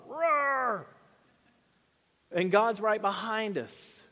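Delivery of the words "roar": a high little mock roar; playful high roar; mock cub roar. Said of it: "we're like".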